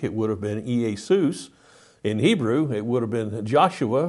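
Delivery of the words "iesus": Iesus said the Greek way, ee-ay-SOOS; Greek pronunciation. Shown as a demonstration. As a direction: say Iesus in Greek, stressing the last syllable. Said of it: "In Greek".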